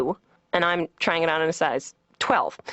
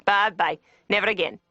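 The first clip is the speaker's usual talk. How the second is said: hammed-up Scottish brush-off; bad Scottish accent; 2 semitones higher than usual. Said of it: "like, some really bad, you know, Scott".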